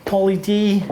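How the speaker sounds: put-on Boston accent, "Poly D" drawled out. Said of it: "That's my terrible Boston accent".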